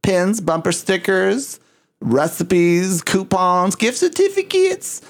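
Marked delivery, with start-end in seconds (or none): 3.8-5.0 silly, very high-pitched flourish; playful squeal. Pretend. Hamming up the merchandise list.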